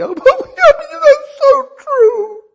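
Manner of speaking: high, loud, slow wailing sob; sobbing cry. Retold or imitated. Preacher reliving weeping over the Bible, high wailing sob. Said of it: "burst out bawling like a baby".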